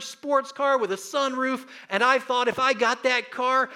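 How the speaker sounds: high, loud, thrilled recollection; excited. Real, not acted. Pastor recalling the red sports car to his church, thrilled and loud. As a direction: gush about the dream sports car.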